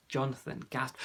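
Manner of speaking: plain reading voice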